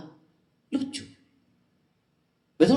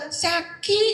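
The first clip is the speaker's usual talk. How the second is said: lightly pitched-up Minang "Saki"; Padang man says sick; 14 semitones higher than usual. Retold or imitated. Padang man telling the Sundanese he is sick, light Minang accent. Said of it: "Padahal udah".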